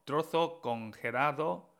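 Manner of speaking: ordinary talk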